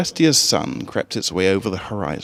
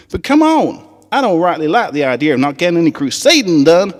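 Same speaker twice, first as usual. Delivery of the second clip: much higher, Southern twang, eager; high country filly voice; 9 semitones higher, 7 dB louder than usual. Acted. Apple Bloom rallying the Crusaders on the school trip, high Southern twang.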